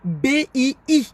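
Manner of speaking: "B I I" spelled out slowly, letter by letter; spelled syllables. Shown as a demonstration. Correcting the viewers, spelling "B I I" slowly. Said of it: "c'est deux syllabes".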